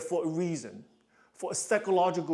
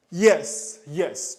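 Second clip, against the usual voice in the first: normal talking voice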